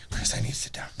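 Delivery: breathy whisper, voice dropped right down; whispered plea. Retold or imitated. Old lady begging Chris for a seat, in a whisper. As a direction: whisper it like you're about to faint. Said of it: "She's just like".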